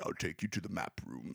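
low, soft statesman voice; lightly voiced leader, hushed and deep; Churchill. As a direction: drop low and quiet with a hint of the old orator. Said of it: "obviously I'm doing the voice for Churchill".

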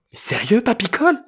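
put-on teen girl calling out high; crying out. A narrator's reading. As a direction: call out in exasperation. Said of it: "cria-t-elle".

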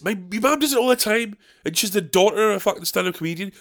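usual speaking voice

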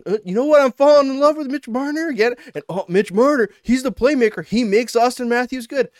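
high, gushing fan voice; mock fan infatuation. Imitating fans falling for their player again, mock gushing.

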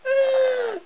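thin high-pitched scream; acted "ah" scream. A narrator's reading.

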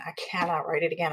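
retold quiet despair. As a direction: let it sink, barely holding back tears. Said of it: "I started crying 'cause I was like".

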